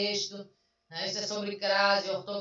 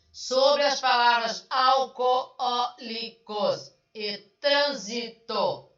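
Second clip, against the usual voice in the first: slow emphatic reading; 3 semitones higher, 6 dB louder, slower than usual. Shown as a demonstration. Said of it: "teacher reads question slowly".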